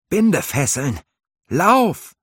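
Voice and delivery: high, loud warning cry, rising to a yell on Lauf; alarmed shout. A narrator's reading. Halvar yelling a warning from the raven swarm. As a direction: shout the danger, then run.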